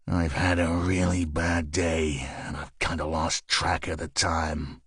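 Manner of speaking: low, slow, menacing detective; glowering menace. Acted. Detective menacing the nervous landlord, low and slow. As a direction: lean over the bar and make him nervous, low and slow. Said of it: "He glowered at the landlord".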